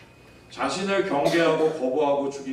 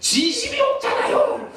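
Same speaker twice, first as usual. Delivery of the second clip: very high, loud, slowed mock scream; imitated child's shout; 6 dB louder, slower than usual. Son protesting to his parent about an insincere apology, shouting. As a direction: shriek it like an outraged little boy. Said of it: "요셉이가 저한테 소리를 치면서".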